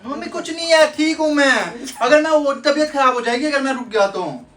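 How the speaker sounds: very high, loud complaint; insistent protest. Real, not acted. Son insisting to mother he is fine, loud high protest. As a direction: protest at the top of the voice.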